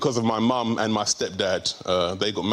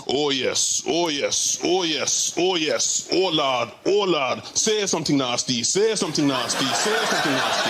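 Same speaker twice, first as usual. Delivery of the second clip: imitating stepdad in the throes, rhythmic and raised; amorous stepdad; 4 semitones higher than usual. Stepdad overheard through the bedroom wall, mock moaning. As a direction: chant it like an overexcited lover. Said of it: "I can hear my stepdad going".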